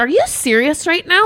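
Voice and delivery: high-pitched incredulous retort; retold outburst, voice shooting up; exasperated disbelief. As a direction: drop the professional tone in disbelief. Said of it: "all my professionalism was just, like, out the door".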